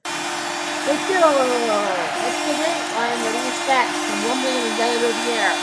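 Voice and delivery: loud, stretched announcer goal yell; goal call shout. Acted. Announcer shouting a goal call, loud and stretched. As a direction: roar the team name out.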